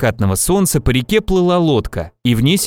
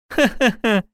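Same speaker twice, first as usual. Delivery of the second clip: high acted giggle; 8 semitones higher than usual. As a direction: burst into a light giggle. Sister laughs at serious warning. (высокий смешок)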